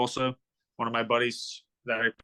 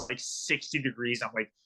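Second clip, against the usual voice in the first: ordinary talk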